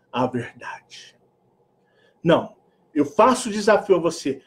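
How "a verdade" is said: ordinary talk, nothing marked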